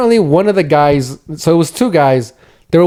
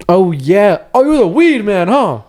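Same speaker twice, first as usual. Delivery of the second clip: higher, sneering put-on voice; mock taunt; 6 semitones higher, slower than usual. Robbers taunting the cousin, higher mock sneer. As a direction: taunt him like a street tough.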